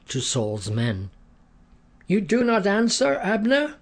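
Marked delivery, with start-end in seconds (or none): 2.1-3.8 high calling voice across a distance; shouting across a valley. A narrator's reading. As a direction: call out to be heard on the far hill. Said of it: "shouted across the valley".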